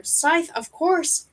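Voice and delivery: high, slow growl; growled exclamation. A narrator's reading. Crindle recognising the killer's scythe, growled exclamation. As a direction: growl it out slowly in sudden realisation. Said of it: "Crindle growled".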